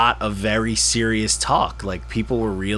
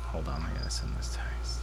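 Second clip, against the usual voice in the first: whispered aside; 5 semitones lower, 12 dB quieter than usual. Real, not acted. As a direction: breathe it under your breath. Aside while texting.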